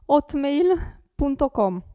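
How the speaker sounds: hotmail said with no h, otmail; silent h. Shown as a demonstration. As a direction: say hotmail dropping the h. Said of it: "Non si deve pronunciare la H". (hotmail senza pronunciare la h)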